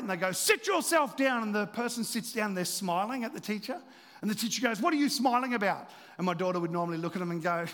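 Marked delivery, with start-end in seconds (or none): 0.3-1.4 raised, drawn-out order from a fed-up teacher; mock an exasperated teacher, loud, high and slow; irate teacher. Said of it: "they get really irate". 4.8-5.9 louder, higher mock scolding; irate teacher. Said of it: "they get really irate".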